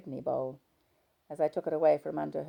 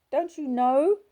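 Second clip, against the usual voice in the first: angry; 12 semitones higher, 12 dB louder, slower than usual. A narrator's reading. Wife in tearful quarrel.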